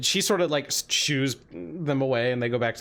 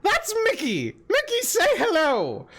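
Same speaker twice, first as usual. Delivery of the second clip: high, delighted hag calling out. Acted. Hag introduces her creature Mickey, high and delighted. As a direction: gush proudly in a high hag voice.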